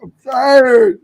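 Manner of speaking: tired host shouting her complaint; loud exasperated complaint. Real, not acted. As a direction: blurt it out, worn out and fed up. Venting about a rough week.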